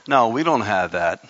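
ordinary delivery

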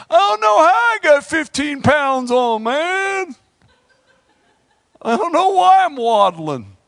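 high, shouted whiny glutton; mock stuffed overeater. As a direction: ham up a stuffed, waddling eater. Mock overeater complaining at Christmas.

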